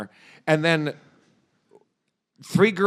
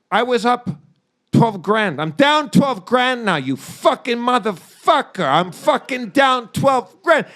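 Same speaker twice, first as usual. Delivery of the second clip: high, loud, slow mock shouting at his buddy; furious gambler rant; 7 semitones higher, 4 dB louder, slower than usual. Vegas gambler yelling at his friend about his losses, mock furious shout. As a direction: rant like a gambler who just lost big, yelling. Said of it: "He's like".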